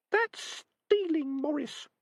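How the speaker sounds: a tiny rat scolding gently; a rat reproaching her friend, prim and high; prim little rat. Acted. Rat Peaches objecting.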